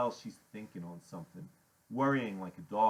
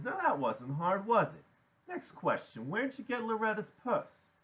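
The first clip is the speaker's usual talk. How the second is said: lilting higher, mock-friendly interrogation; patronizing questioning; 11 semitones higher, 3 dB louder than usual. A narrator's reading. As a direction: quiz him condescendingly, voice rising.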